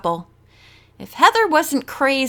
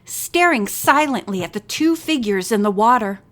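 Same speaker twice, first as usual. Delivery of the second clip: ordinary delivery, nothing marked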